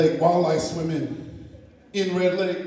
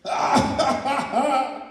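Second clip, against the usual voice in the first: put-on old eagle laughing; 7 semitones higher than usual.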